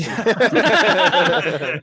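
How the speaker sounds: laughing. Real, not acted. Joke about overheard thoughts.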